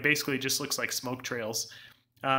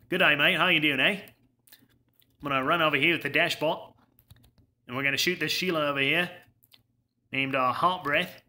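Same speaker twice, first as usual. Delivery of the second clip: put-on Australian accent. Streamer obliges a viewer while playing, terrible Australian accent. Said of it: "A non-Australian accent".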